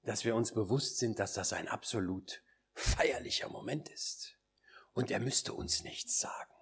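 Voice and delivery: low, whispered, reverent musing; hushed solemnity. Retold or imitated. Preacher recalls a fatherly friend pondering standing before the Lord, hushed solemnity. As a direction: whisper it with long, awed pauses.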